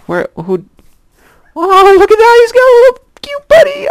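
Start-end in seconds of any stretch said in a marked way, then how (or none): 1.6-3.9 very high, loud squealing over a bunny; gushing delighted shriek; excited squeal. Real, not acted. Spots a baby bunny.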